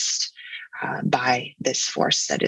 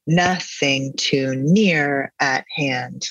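very slow, hard beat on "Noth" in "Nothing" and on "near"; drawn-out sing-song meter on "Nothing too near at hand"; exaggerated dactylic stress; much slower than usual. Shown as a demonstration. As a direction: lean on "Noth" and "near", let "ing too" and "at hand" fall unstressed. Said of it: "like that".

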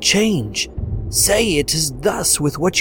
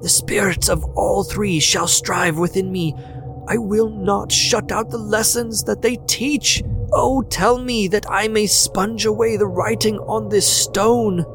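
high, raised, fervent vows; agonized entreaty; 10 semitones higher than usual. A narrator's reading. Scrooge on his knees vowing to the spirit, high anguished entreaty. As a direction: swear it with desperate hope. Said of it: "In his agony".

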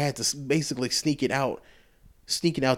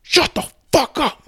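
high, loud outburst, far above usual voice; retold yell; 7 dB louder than usual. Tired owner yelling at a barking dog, exasperated shout. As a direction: yell it, fed up, restarting louder. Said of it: "I just fucking yelled".

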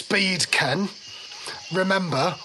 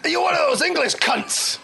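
high, accusing soldier voice; flustered soldier; 13 semitones higher, 3 dB louder than usual. Acted. Soldier suspecting an English spy, flustered accusation. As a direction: fling out the accusation, pitched up. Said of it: "is getting a bit flustered now".

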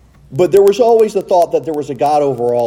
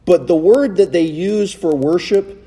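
ordinary talk, nothing marked

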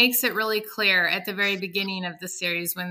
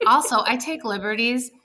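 usual speaking voice

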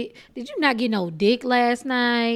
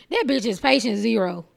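ordinary delivery

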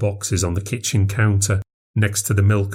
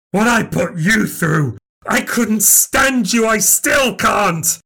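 much higher, slowing into a shout; hateful shout; 10 semitones higher, slower than usual. Acted. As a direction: hurl pure loathing.

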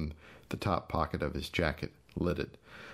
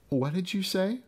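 much higher, mocking question; mock surprise; 13 semitones higher than usual. Gus mockingly asking his mute brother, high pitched. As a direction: pretend you didn't hear.

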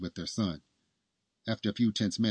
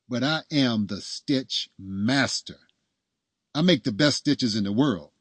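emphatic brag, raised in pitch and volume; boasting; 4 semitones higher, 6 dB louder, slower than usual. A narrator's reading. Doctor bragging as he stitches, proudly emphatic. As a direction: boast with weight on the key words.

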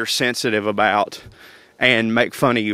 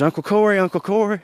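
put-on higher-pitched child calling for an uncle; lightly put-on small kid's voice; imitating excited little girl; 8 semitones higher than usual. Niece waking her uncle in the morning, imitated high child voice.

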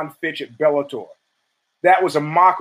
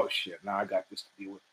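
muttered aside; 9 semitones lower, 16 dB quieter, faster than usual. Retold or imitated. Inner thought aside to himself.

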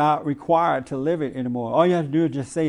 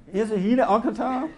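higher-pitched question breaking into laughter; amused punchline; 6 semitones higher than usual. Real, not acted. Host joking about the caller's own name, amused.

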